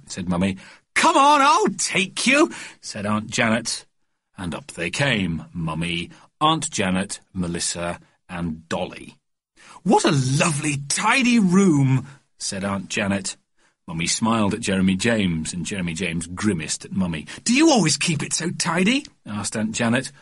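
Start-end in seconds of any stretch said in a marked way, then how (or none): 0.9-2.5 very high, slow doting aunt; gushing aunt. A narrator's reading. Aunt taking Melissa up. 9.8-12.1 very high, slow, drawn-out praise; gushing aunt. A narrator's reading. Aunt admiring tidy room. 17.5-19.1 gushing aunt. A narrator's reading. Aunt questioning nephew.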